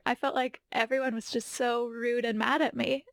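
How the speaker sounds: usual speaking voice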